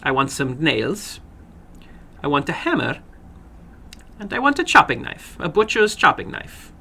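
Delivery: little man ordering the maid about, a lightly voiced eerie glee; little man giving orders. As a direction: list the tools, savouring the chopping knife.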